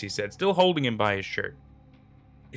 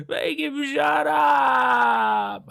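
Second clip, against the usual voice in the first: very high, very loud, slow drawn-out scream; terrified man begging for silence; screaming in terror; 7 dB louder, much slower than usual. A narrator's reading. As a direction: scream it long and raw. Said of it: "Hutch just kept on screaming".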